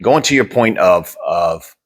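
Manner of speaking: ordinary talk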